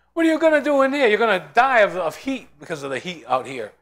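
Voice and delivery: loud, high taunt fading into a jeer; mocking taunt. Crowd taunting Noah as he enters the ark, loud mocking. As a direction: jeer at the man walking in. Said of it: "they laughed at him, mocked him".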